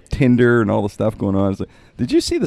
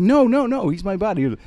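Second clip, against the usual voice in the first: high-pitched insistent "no" run easing down; acted-out hasty objection; retold protest; 10 semitones higher than usual. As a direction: protest it high, then ease off. Retelling his own hurried protest.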